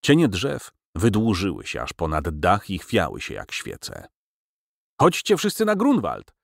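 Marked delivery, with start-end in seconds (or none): 5.0-6.3 crowed out, much higher. A narrator's reading. Professor crowing to the whole room, shrill high cry. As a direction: crow it like a rooster. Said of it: "zapiał profesor".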